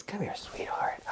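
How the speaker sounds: very soft, high, half-whispered coo; hushed, high sweet-talk; cooing to a pet. Real, not acted.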